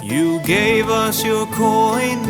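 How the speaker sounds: ordinary talk, nothing marked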